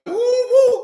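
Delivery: high, loud hooting with a laugh; mock lusting hoots. A dude chasing a woman, hooting high and loud. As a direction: hoot like a smitten guy. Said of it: "heard no dudes run away like".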